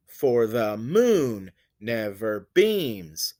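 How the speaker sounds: slow, strong swell on "moon" and "beams"; anapestic beat. Shown as a demonstration. Teacher demonstrating two unstressed then stressed, anapestic beat. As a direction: pass lightly over "For the", land long on "moon". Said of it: "two unstressed syllables followed by a stressed one".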